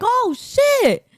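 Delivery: very high, loud stunned exclamation; shocked. Retold or imitated. Friends seeing a shooting star, very high shocked cry. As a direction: gasp it out after the shooting star. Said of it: "looked at each other and was like".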